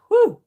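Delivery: short, high, loud whew; emphatic whew. Real, not acted. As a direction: punch out a sharp whew. Exclaiming over that full moon.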